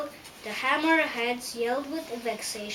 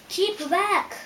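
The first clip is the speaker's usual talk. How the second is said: high, loud warning cry; 7 semitones higher, 5 dB louder than usual. A narrator's reading. Hidden voice warns approaching travelers off, crying out. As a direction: call out sharply to halt intruders. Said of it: "voice cry out".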